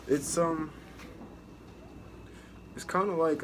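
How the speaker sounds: ordinary talk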